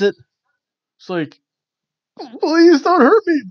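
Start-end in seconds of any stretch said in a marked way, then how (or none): 2.4-3.4 high, hammed-up pleading; mock fear. Reacting to angry Japanese he can't understand, mock-frightened plea. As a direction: beg in a much higher mock-frightened voice.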